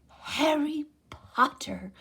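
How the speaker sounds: high, slow squeaky elf voice; a high, drawn-out elf greeting; high-pitched creature. A narrator's reading. Elf greeting Harry, high and slow. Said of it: "said the creature in a high-pitched voice".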